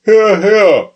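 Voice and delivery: high, loud cry of approval; cheering listener. Acted. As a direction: shout approval from the crowd. Audience member cheering.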